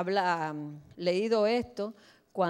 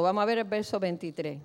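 usual speaking voice

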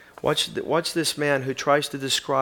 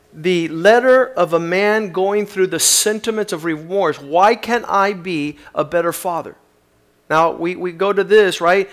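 louder preaching, higher than his opening talk; 4 semitones higher, 8 dB louder than usual. Acted. Preacher turns from the letter to the congregation, preaching. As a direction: drive it home to the congregation.

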